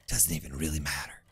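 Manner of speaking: quiet low repeat. Real, not acted. Streamer echoing himself to himself, quiet and low. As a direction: mutter it again under the breath, low.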